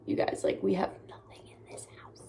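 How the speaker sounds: trailing into a whisper; hushed confession. Real, not acted. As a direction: drop to a whisper, confiding in the viewers. Confiding how bad it is.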